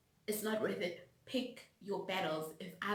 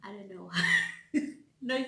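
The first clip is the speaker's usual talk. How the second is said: laughing; 7 dB louder, faster than usual. Real, not acted. Catching herself mid-point, giggling. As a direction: dissolve into laughter.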